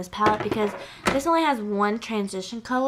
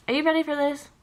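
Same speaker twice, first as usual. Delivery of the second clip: ordinary delivery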